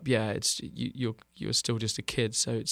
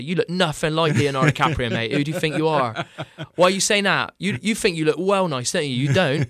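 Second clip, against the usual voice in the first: loud, higher-pitched West Country heckling; mocking West Country lad; 4 semitones higher, 6 dB louder than usual. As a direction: jeer in a broad West Country accent, knocking someone down. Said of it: "people would just come up to me and say".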